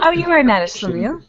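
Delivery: laughing reassurance. Real, not acted. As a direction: tease him kindly through a laugh.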